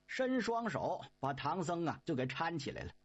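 ordinary delivery

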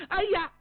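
high-pitched "aiya" of outrage; shocked cry. Acted. Woman just insulted by the man she courts, shocked cry.

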